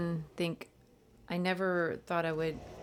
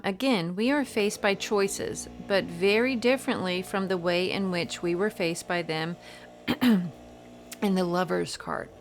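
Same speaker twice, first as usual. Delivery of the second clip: reading aloud; 2 semitones higher, 5 dB louder than usual. Real, not acted. Tarot reader reading a book passage to viewers, emphatic read-aloud. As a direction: read it out with a lively lilt.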